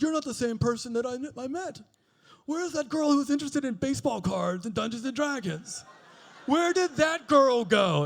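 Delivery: high, mock-aggrieved wail; indignant protest. Man asking his changed partner where that girl went, hammed-up indignation.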